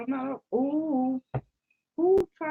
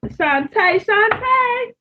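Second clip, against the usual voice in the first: calling the name higher and louder; louder, higher call of Shantae; 10 semitones higher, 9 dB louder, much slower than usual. Real, not acted. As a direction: call out to her, rising.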